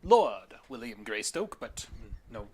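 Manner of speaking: slow, high, grand self-introduction; eccentric British lord. Acted. Lord introduces himself to strangers, slow and grand. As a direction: savour your own title with British airs.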